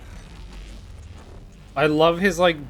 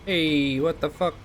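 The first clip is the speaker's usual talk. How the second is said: put-on Brooklyn accent; 3 semitones higher, slower than usual. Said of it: "a Brooklyn accent".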